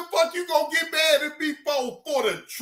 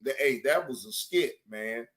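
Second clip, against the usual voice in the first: much lower, pleading imitation; imitating Cam Lo; 11 semitones lower than usual. Imitating Cam Lo pleading with Johnny Blaze. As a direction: plead low like Cam Lo begging. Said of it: "He said".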